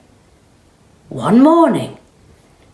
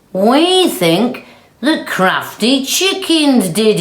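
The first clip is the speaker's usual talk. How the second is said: slow accusing; 7 semitones higher, 4 dB louder than usual. Acted. Cow accusing chickens.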